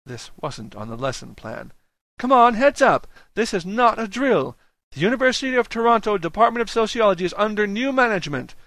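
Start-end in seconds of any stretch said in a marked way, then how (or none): 2.2-8.5 high, loud, driving announcement; strident harangue. A narrator's reading. Grad student seizes the lecture mic, strident. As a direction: harangue a sleepy hall at full voice. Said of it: "the woman's strident harangue".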